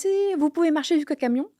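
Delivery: imitation aiguë du pompier qui insiste; pompier insistant. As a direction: insister d'une voix haut perchée. Pompiers insistent malgré sa douleur. (pompier qui insiste, voix aiguë)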